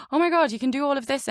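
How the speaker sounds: gasp, then a high, fast rush of delight; excited gasp. Retold or imitated. Voice agency gushing over her accent skills, gasping and excited. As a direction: gush like someone who just found a gem. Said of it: "there was immediately this, like".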